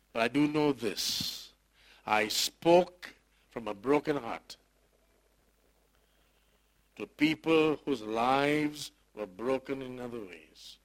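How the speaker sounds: slow, weighty testimony from the pulpit; earnest preaching. Acted. Pastor testifies to his congregation, slow earnest preaching. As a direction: lay each word down slowly, preaching.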